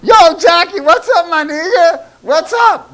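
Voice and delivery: high, loud street slang greeting to Jackie; slangy street greeting. Retold or imitated. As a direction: hail her like an old buddy, loud and high. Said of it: "came in there and go".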